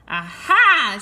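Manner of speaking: a very loud triumphant scoff; contemptuous aha. A narrator's reading. Sorceress catching the prince in the tower, loud and scornful. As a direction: pounce on him with scorn. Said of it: "she exclaimed with contempt".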